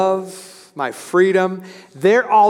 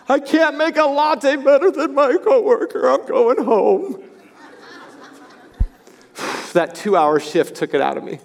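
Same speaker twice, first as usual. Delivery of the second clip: much higher whiny complaint, then a tired sigh; mock whiny young worker; 7 semitones higher than usual. Preacher mocking a coddled young worker, whiny. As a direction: lampoon a fragile young employee giving up.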